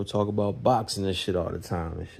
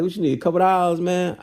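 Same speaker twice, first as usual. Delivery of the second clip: much higher, louder teasing offer; teasing friend; 11 semitones higher, 6 dB louder than usual. Retold or imitated. Hammer teases narrator the next day, high and teasing. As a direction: rib him with a grin.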